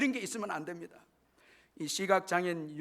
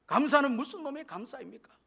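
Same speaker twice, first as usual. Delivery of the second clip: acted indignant boy, pitched up; angry outburst; 8 semitones higher, 3 dB louder than usual. Blind boy objecting to his mother's thanksgiving offering, acted angry outburst. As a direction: retort like a son flaring up at his mother. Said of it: "버럭 화를 내면서".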